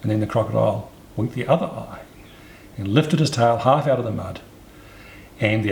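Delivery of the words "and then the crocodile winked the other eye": plain reading voice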